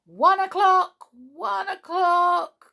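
very high, loud, drawn-out cry. A narrator's reading. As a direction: pixies calling the hour at full pitch. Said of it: "cried the pixies".

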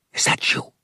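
hushed, whispered question. A narrator's reading. Boy in bed checking who is outside, whispered. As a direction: whisper it towards the door. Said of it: "he whispered".

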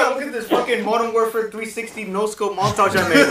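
loud, high, laughing show-off; mock bragging. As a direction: boast loudly about it, half laughing. Mock-bragging about an old montage.

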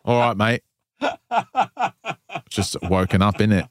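put-on joking Cockney accent, slightly laughing; lightly put-on Cockney greeting. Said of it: "Like, is it Cockney?".

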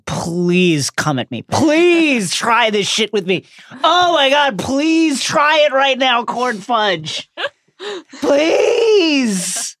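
slow, drawn-out mocking plea to fight; taunting dare. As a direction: egg them on, savouring each please. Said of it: "He's just like".

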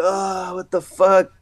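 high, awed disbelief; retold amazement. Skater learning of the surprise band, retold amazement. Reliving his shock at surprise.

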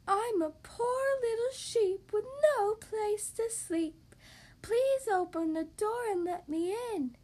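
high, slow fake-lamb pleading; wolf posing sweetly as a poor little sheep. A narrator's reading. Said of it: "in a sweet little voice".

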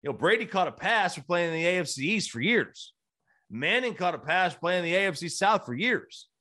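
usual speaking voice

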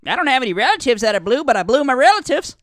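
high, loud, fast yokel; hillbilly voice. Retold or imitated. Hillbilly answers doctor.

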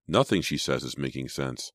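usual speaking voice

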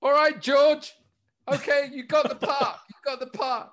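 very high, loud panicked giving in; mock terrified surrender. Casting director pinned in a chair, high panicked surrender. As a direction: squeal out a scared surrender. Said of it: "And I went".

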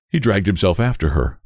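usual speaking voice

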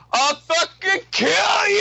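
high, drawn-out imitation yell; mock shout to the sky. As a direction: holler it slowly skyward, hammed up. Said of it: "he shouts up to the sky".

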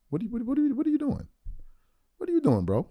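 can't believe what he's seeing; incredulous. Real, not acted.

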